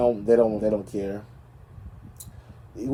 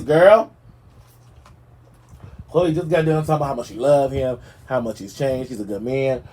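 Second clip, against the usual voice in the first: ordinary talk, nothing marked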